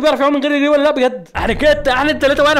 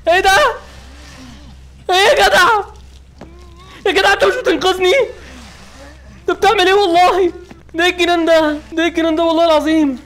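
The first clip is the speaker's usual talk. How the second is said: outraged yelling; 7 semitones higher than usual. Real, not acted. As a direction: yell at him for betraying you. Betrayed outrage at rescuer. (صراخ غضب واستنكار)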